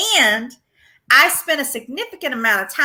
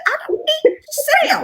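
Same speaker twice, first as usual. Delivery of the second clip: high mock shout. Retold shout at husband.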